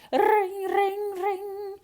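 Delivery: high, loud ringing call; bell ringing. Acted. Patrick rings his bell to call the snakes, high ringing chant. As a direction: ring it out like a magic bell.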